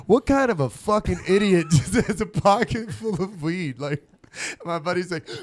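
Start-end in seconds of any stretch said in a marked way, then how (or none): 0.1-3.8 incredulous scolding. Retold or imitated. Cop mocking buddy's pocket of weed.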